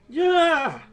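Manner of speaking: high, very loud cry; loud outburst. Retold or imitated. Vlogger reacting to a creepy documentary, loud high outburst.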